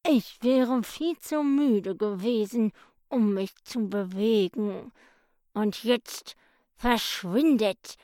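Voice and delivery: leicht gespielter schläfriger Hund, langsam; müder, fauler Mops. A narrator's reading. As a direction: klingt wie ein Hund, der nur schlafen will. Lazy dog Otto Mops speaks. (der faule Mops verscheucht die Ermittler, träge)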